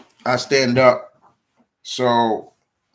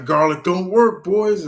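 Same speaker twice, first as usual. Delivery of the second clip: taunting vampire; 8 semitones higher, 5 dB louder than usual. Retold or imitated. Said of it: "And he's like".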